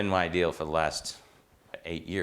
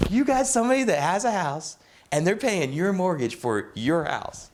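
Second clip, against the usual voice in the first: much higher and louder, slowing down in wonder; marveling; 12 semitones higher, 4 dB louder than usual. Retold or imitated. Old mentor marveling that tenants pay the mortgage, high amazed voice. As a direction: marvel at it out loud.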